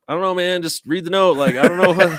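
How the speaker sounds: high, loud protest as the messenger; flustered messenger. Acted. Messenger protesting to the man who yelled at him, flustered. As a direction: push the voice up high, defensively pleading ignorance.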